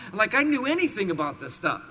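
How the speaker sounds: ordinary talk, nothing marked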